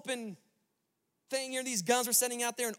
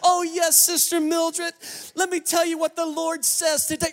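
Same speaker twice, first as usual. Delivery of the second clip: overly pious phone voice; 5 semitones higher, 6 dB louder than usual. Retold or imitated. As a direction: ham up a saintly mom on the phone. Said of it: "trying to say the right thing".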